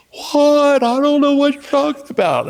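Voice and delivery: high mock-innocent denial; squeaky voice acting clueless; [name] playing innocent. Friend [name] denying the prank, high fake innocence.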